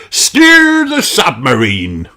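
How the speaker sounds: slow, high, loud pirate bellow; bellowed order. Acted. Redbeard calls to Mister Elf. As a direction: bellow the name like a captain. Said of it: "bellows Redbeard".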